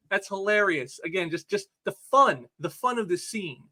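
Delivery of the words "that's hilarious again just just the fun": ordinary talk, nothing marked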